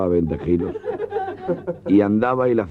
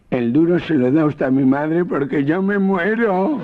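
put-on dying boy; 10 semitones higher, 3 dB louder, slower than usual. Exhausted boy says he's dying.

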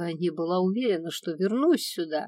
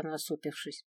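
ordinary delivery, nothing marked

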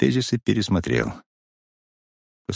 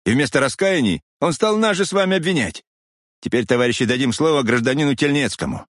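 furious shout; 7 semitones higher than usual. A narrator's reading. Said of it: "Кремнёв ещё больше разъярился и крикнул на весь зал".

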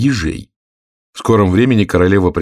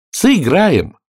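higher-pitched eager call; 9 semitones higher than usual. A narrator's reading. Alice shouts her answer to the queen, voice raised high. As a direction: call it out in a lifted voice. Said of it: "крикнула Алиса".

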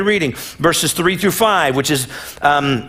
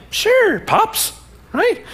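much higher, breezy and eager; cheerful kid voice; much slower than usual. Retold or imitated. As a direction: play the boy as a clueless happy teen.

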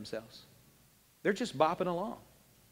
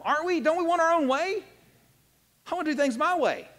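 higher, louder preaching; fervent challenge; 9 semitones higher, 5 dB louder than usual. Real, not acted. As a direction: press the congregation, rising. Preacher raising intensity.

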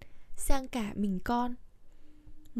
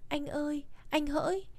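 soft, higher pretend lament; mock mourning; 4 semitones higher, 6 dB quieter than usual. Wife showing husband her future lament, mock mourning. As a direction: lightly play at wailing for a spouse. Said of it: "mình khóc là gì nào".

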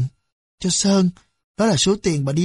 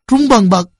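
ordinary delivery, nothing marked

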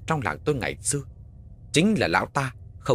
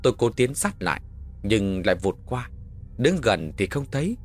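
ordinary delivery, nothing marked

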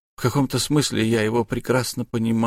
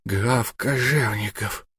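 slow, stunned repetition of Граф Кожевников; dazed man repeating the husband's name, slow and mechanical; dazed, repeating mechanically; 3 dB quieter, slower than usual. A narrator's reading. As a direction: say Граф Кожевников slowly, as if on autopilot. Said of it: "твердил Марков, словно заведённый".

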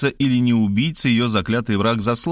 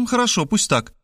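dismissive; 9 semitones higher than usual. A narrator's reading. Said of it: "отмахнулась Мархалёва".

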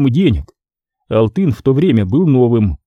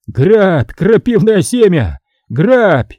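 higher, louder bitter cry; furious shout; 7 semitones higher, 4 dB louder, slower than usual. A narrator's reading. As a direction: fling the curse at the robber. Vaska throws coins down.

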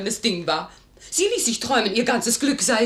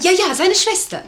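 indignant; 8 semitones higher, 7 dB louder than usual. Acted. Lady insists, indignant.

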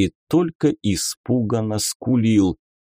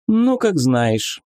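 higher, teasing lady; lady inquisitor voice; 7 semitones higher, slower than usual. Acted. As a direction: play a coy, mocking lady. Beatrisa shrugs off refusal. (игривая дама-инквизитор)

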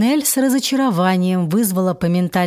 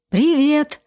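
bright greeting; 7 semitones higher than usual. Acted. Nineel greets the giant god.

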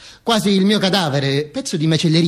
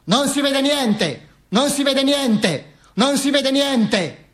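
higher, slow shouted complaint; crowd complaining; 8 semitones higher, much slower than usual. Retold or imitated. The crowd complains it cannot see, high and slow. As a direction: call out from the back of the crowd. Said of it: "gridava la gente".

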